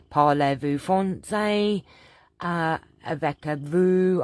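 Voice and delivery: put-on loud, slow Cockney-accented French; Del Boy's Cockney French. Cockney French impression for her viewers. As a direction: ham up Del Boy mangling French in broad Cockney. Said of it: "I'd love to do impression".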